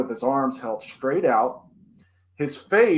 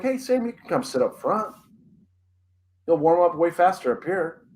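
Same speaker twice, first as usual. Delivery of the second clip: lightly voiced dad, higher pitched invitation; coaxing dad; 4 semitones higher than usual. Friend's dad inviting boy to the front seat, lightly voiced coaxing. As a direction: coax a kid in a raised, friendly imitation.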